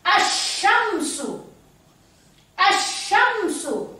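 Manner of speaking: slow "Asyamsu", the l gone, sy held long; assimilated alif lam. Shown as a demonstration. Teacher repeating it for children, slow assimilated "Asyamsu". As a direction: stretch "Asyamsu", sliding straight from A into sy. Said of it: "Langsung masuk ke huruf setelah alif lam".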